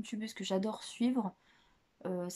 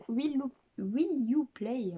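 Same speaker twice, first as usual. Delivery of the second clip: French-accented English, slowed and higher; bad English accent; 4 semitones higher, 5 dB louder, much slower than usual. Real, not acted. Reading a book title to viewers, catastrophic English accent. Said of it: "mon accent anglais est catastrophique".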